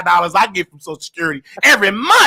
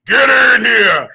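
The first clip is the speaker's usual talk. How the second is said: loud, gruff command imitating grandmother; mock raspy holler; 7 dB louder than usual. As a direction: imitate a mannish Southern grandmother calling out. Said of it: "deeper, raspy voice and everything".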